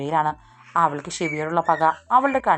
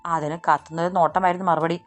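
ordinary delivery, nothing marked

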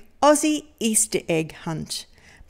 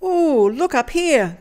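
higher, brighter call from a treetop; Koala calling down; 6 semitones higher than usual. A narrator's reading. Koala calling down to the searchers from her tree. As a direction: call out excitedly to the others below. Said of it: "Koala called from high up".

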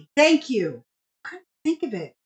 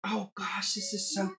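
soft, hushed cooing; hushed gushing; 4 semitones lower, 12 dB quieter than usual. Retold or imitated. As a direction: coo it under your breath. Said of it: "I was like".

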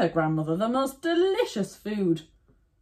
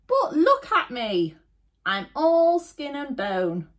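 higher, laughing granny voice; a hint of a thin old woman; 8 semitones higher than usual. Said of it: "said the old woman".

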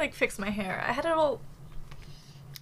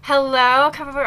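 ordinary talk, nothing marked

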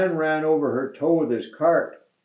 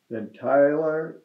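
ordinary delivery, nothing marked

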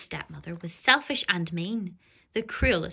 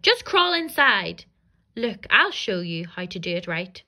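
nasty witch; 6 dB louder than usual. Acted. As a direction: snarl it impatiently. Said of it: "The nasty witch cried".

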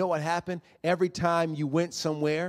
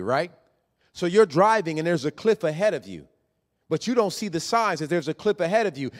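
usual speaking voice

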